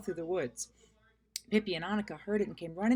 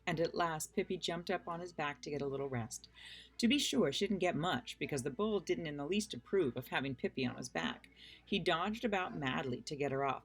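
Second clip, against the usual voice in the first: ordinary delivery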